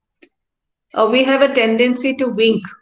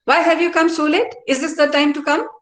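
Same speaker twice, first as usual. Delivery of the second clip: higher, sharp scolding question; angry scolding; 6 semitones higher than usual. Shown as a demonstration. Teacher scolds a latecomer, annoyed and raised. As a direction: scold the late student. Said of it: "You can understand, "I am angry".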